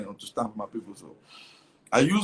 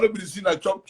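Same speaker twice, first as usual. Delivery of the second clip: normal talking voice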